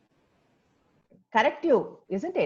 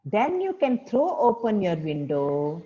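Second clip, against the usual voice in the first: slow phrase-by-phrase recitation with long pauses; measured teacher's modelling, leaving gaps to echo; repeat-after-me model. Shown as a demonstration. Teacher leads pupils through the crying poem, slow repeat-after-me delivery. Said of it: "So repeat after me".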